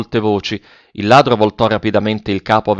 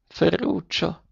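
faint, higher-pitched call; barely intelligible; 6 semitones higher, 9 dB quieter, slower than usual. A narrator's reading. Terrified grandmother coming round to her grandson, barely intelligible. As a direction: call the name softly and weakly, as if teeth chatter. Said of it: "con voce appena intelligibile, battendo i denti".

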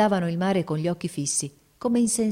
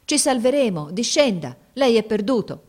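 urgent shouted warning, higher and louder; grido concitato; 5 semitones higher, 5 dB louder than usual. A narrator's reading. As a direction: call out urgently over the wreck. Sailors shout to the man on deck. (grido concitato e urgente)

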